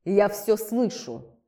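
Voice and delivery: slow, menacing rustle of a voice; menacing rustling voice. Acted. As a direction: rustle it out threateningly. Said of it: "Грозно прошелестел голос".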